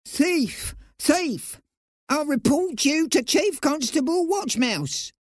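very high mouse accusation; piping little voice. A narrator's reading. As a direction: pipe up accusingly as a tiny mouse. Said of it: "the little voice piped up again".

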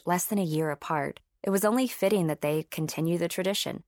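plain reading voice

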